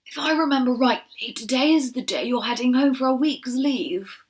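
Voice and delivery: an aged general giving gentle orders; kindly old leader. Acted. Old leader sends Shiloh home on leave, kindly.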